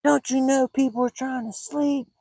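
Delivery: high-pitched mock screech, volume held back; screaming lady impression. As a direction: scold like an irate neighbor in a high voice. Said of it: "And she's screaming".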